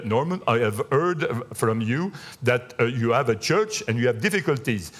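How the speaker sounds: put-on a mild Indian English accent, unhurried. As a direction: imitate the Indian businessman on the phone in Indian English. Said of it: "un Indien de l'Inde".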